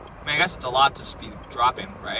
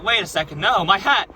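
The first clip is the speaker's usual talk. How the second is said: higher, louder mock dismay; alarmed protest; 6 semitones higher, 4 dB louder than usual. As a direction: cry out as your prized thing is taken. Protesting as hat is stolen.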